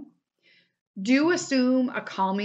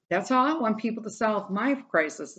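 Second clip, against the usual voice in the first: ordinary talk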